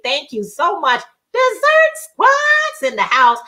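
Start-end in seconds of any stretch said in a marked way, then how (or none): 1.3-3.4 high, drawn-out cheer; sing-out announcement, pitched way up; hype shout-out. Real, not acted. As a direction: whoop it slowly for the fans. Hypes up fan group in chat.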